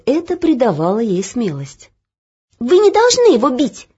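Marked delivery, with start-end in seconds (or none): 2.6-3.9 high, loud and firm. Acted. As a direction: stand your ground. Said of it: "твёрдо сказала Оля".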